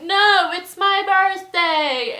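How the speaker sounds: slow, high, loud refusal. Retold or imitated. Birthday host rejecting the request, slow loud high protest. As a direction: refuse loudly, dragging the words out. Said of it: "he just kept saying this and getting louder".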